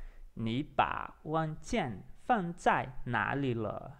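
very slow, spaced-out Mandarin; careful test repetition. Shown as a demonstration. Speaking to Google to test pronunciation, slow and careful. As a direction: pronounce each word separately for the machine. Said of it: "vou repetir aqui a frase".

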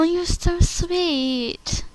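touched. Acted. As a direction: melt at the kind words. Audition line, touched.

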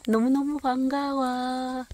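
high, slow, loving gush; gushing delight. Real, not acted. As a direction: coo at it with delight. Greets the mushroom lovingly.

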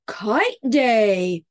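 a bright, cheerful call of the title; happy shout. Acted. Bear shouting happily to the sky, a mild excited shout. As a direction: announce it with joy, not a full yell. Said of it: "He smiled and then shouted".

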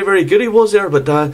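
ordinary talk

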